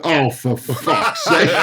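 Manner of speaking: slow exasperated groan through laughter; laughing groan. Retold or imitated. Player retelling a dressing-room shock, groaning slowly through laughter. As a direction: drag it out, laughing in despair. Reacting to Sarkozy's look.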